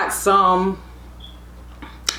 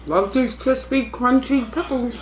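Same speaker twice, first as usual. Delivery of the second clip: ordinary delivery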